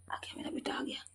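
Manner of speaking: soft unvoiced whisper; tender whispered welcome. Real, not acted. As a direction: hush as the bird comes. Whispering as bird arrives.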